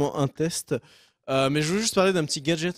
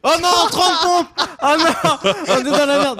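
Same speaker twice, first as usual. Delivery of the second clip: high, loud, laughing dismay; excited yelling; 9 semitones higher, 5 dB louder, slower than usual. Real, not acted. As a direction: yell it in gleeful panic. More subs, more push-ups owed.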